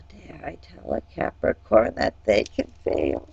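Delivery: a hushed, playful gasp at her own cheek; teasing stage whisper to the viewers; mock-scandalised whisper. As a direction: whisper it like a naughty secret.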